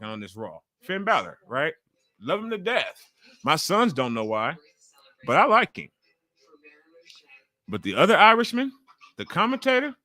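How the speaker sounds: ordinary talk, nothing marked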